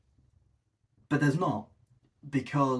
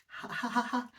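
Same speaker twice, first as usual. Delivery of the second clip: high, soft imitation laugh; mock laughing along; 10 semitones higher, 4 dB quieter than usual. Mocking Ricky laughing along at his own joke, high soft laugh. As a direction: giggle along at your own gag. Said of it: "he's laughing along".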